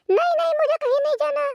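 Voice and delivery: a high little alien protesting; high creature whine, no no no; squeaky alien refusal. Acted.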